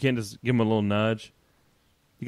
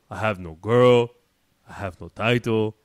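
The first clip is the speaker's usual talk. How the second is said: imitating Rusev's accent; 6 dB louder than usual. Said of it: "sounded like somebody trying to do Arnold".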